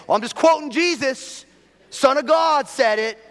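high, loud mock self-defense; hammed-up protest of innocence; defiant excuse. Voices defiant excuse of listeners.

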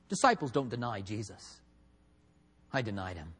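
low, soft, defeated admission; dejected Peter. Retold or imitated. Peter explaining he's no disciple anymore, low and dejected. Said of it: "can you hear him?".

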